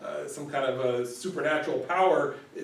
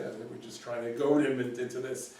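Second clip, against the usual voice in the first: usual speaking voice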